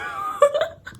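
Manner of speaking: high squealing real laugh; real burst of laughter. Real, not acted. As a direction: let a shrieking laugh burst out. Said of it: "I've never laughed so loudly and hard".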